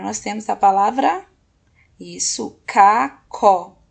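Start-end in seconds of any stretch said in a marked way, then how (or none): 2.7-3.8 CACO as loud CA... CO; emphatic split between CA and CO; syllable by syllable. Shown as a demonstration. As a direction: sound out CACO in two separate beats. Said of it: "na primeira sílaba".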